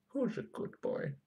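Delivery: high whispered puppy praise; squeaky praising a dog. Pretend. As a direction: breathe it out in a tiny high squeak. Cooing praise at the circuit board.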